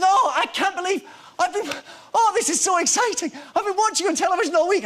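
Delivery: high, loud, breathless fan gushing; old fan telling the actor he's watched all week, gushing; excited old man. Retold or imitated. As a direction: gush like an old man meeting his idol. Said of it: "he starts getting very excited".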